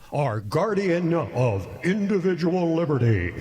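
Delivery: booming hype-man introduction; big announcer intro. Shown as a demonstration. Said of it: "Okay, one more time".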